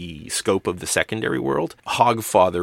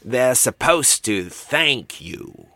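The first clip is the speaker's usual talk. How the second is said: slow, higher-pitched helper's voice; Uncle Heavy; 5 semitones higher, 3 dB louder, much slower than usual. A narrator's reading. Helper nudging the Hogfather, slow raised voice. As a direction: coach it with drawn-out emphasis. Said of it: "Uncle Heavy nudged the Hogfather".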